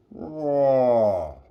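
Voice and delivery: loud roar in a put-on lion voice; mock lion roar. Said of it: "Simba the lion just goes".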